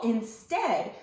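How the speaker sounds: usual speaking voice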